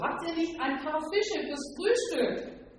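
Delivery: high, lively called question; calling out. A narrator's reading. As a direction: call the question over to the boat. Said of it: "rief er ihnen zu". (hoch gerufene Frage)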